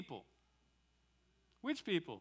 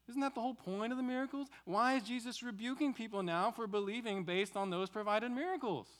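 higher, puzzled voice of a doubter raising a challenge; skeptical objector's voice; 6 semitones higher than usual. Retold or imitated. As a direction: play the questioner pushing back, higher pitch. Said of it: "someone will say".

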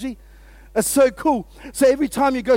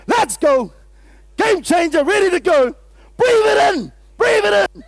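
much higher and louder, rallying cry; pumped-up shout; 9 semitones higher, 7 dB louder than usual. Shown as a demonstration. Pastor voicing a charged-up believer starting the day, shouting. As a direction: fire yourself up for the day. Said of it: "You say".

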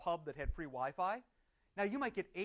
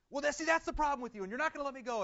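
higher, louder indignant outburst; a hammed-up angry American; mock yelling; 9 semitones higher, 4 dB louder, faster than usual. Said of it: "he starts yelling at him".

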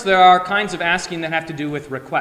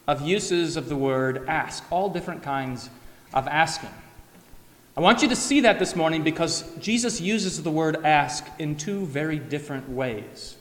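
ordinary talk

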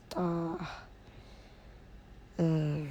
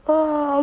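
a big open yawn; 8 semitones higher, 7 dB louder than usual. Real, not acted. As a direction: let out a loud yawn. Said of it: "あくびです。".

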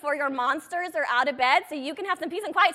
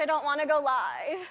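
normal talking voice

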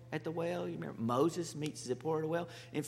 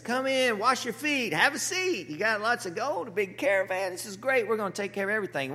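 higher and louder, eager host; eager welcome; 5 semitones higher, 4 dB louder than usual. Retold or imitated. Laban welcoming the rich servant in, eager. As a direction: Laban fawning over the gold. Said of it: "Laban is excited".

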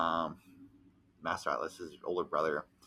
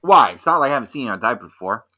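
louder, fast sassy comeback; sassy retort; 8 dB louder, faster than usual. Retold or imitated. Roommate teasing him about the diaper, sassy. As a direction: snap it back quickly. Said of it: "she goes like".

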